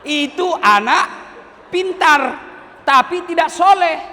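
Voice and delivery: high, loud preaching exclamation building to a shout; preacher shouting his point to the congregation; emphatic verdict. Real, not acted. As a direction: proclaim it so the whole crowd hears.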